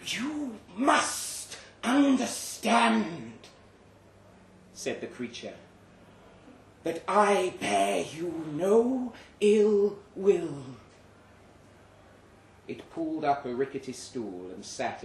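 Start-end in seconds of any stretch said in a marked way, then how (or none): 0.1-3.3 much higher, louder and very slow, otherworldly; creature voice. Acted. Creature speaking to narrator. 6.8-10.6 much higher, louder and very slow, otherworldly; creature voice. Acted. Creature speaking to narrator.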